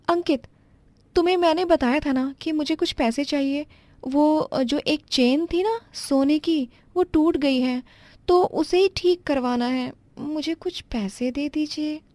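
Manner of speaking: pitched well up, flustered daughter-in-law apologizing; young daughter-in-law. Acted. Wife asks husband for money.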